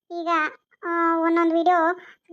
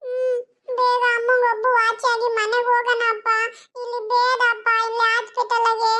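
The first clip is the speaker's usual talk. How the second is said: put-on high, teary child voice wanting to go home; a little boy whimpering to leave the hospital; crying child; 6 semitones higher than usual. (ಮನೆಗೆ ಹೋಗಬೇಕೆಂದು ಅಳುವ ಮಗುವಿನ ದನಿ)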